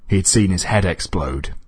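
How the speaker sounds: ordinary delivery, nothing marked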